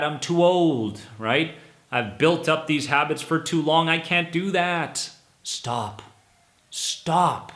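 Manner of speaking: ordinary delivery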